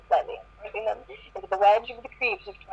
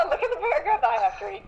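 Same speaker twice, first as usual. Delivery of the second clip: laughing protest; 9 semitones higher, faster than usual. Real, not acted. Embarrassed reader laughing, protesting.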